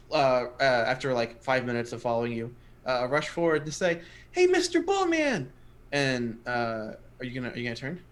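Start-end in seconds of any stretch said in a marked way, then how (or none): 4.3-5.5 put-on high, slow child's call; squeaky kid hailing the minotaur; gnome child calling out. Gnome kid calls to the minotaur, high and eager.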